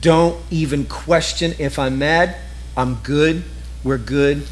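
calm her worries gently; reassuring husband. Retold or imitated. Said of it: "here's what it says".

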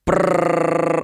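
long tongue-trilled brrr; rolled r drum roll. Shown as a demonstration. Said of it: "Wait, do it".

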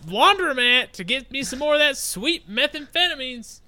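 high, loud mock Southern caller; hammed-up Southern twang, pitched high; Southern accent. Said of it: "unless Southern people really love podcasts".

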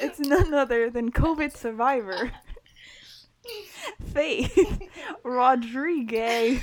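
loud laughing game-show intro; big hype introduction; excited announcement. Real, not acted.

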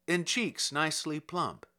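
ordinary delivery

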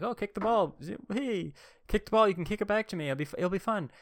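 high, sing-song mock kid calling out; boy calling to a girl to kick the ball, high playful. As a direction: coax her like a carefree little boy. His kid self voiced calling to girl.

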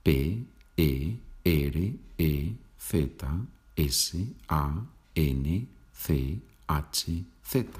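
slowly spelled, letter by letter. Shown as a demonstration. Teacher spelling the surname Pérez Sánchez, spelled out. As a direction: separate each Spanish letter with a short pause. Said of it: "¿Cómo se deletrea?".

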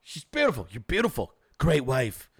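low boastful Donald Trump take; Trump impression. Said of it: "He's like".

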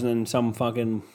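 ordinary talk

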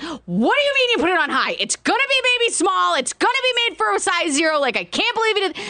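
retelling her own upset reaction, pitched high; upset outburst. Retold or imitated. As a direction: replay the panic over the shrunken dress. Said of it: "And I'm, like, all upset".

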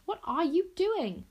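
high, slow and urgent; frantic question; alarmed cry. A narrator's reading. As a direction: plead in alarm. Princess alarmed.

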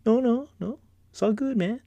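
high, jittery no-no-no; nervous denial. Retold or imitated. Teased about heights, he denies it in a high nervous voice. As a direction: brush it off while scared.

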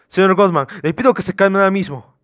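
high, loud demand for calm; forceful order. Acted. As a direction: talk over a hysterical suspect firmly. Officer orders suspect to calm down.

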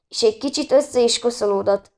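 ordinary delivery, nothing marked

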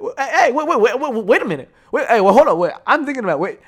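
loud, high, rapid-repeated protest; urgent interrupting. Real, not acted. Podcaster stopping himself about commitment issues, urgent interrupting. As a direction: shout to halt everything mid-thought.

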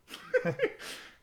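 breathless high laugh. Real, not acted. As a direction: burst out laughing. Laughing at the story.

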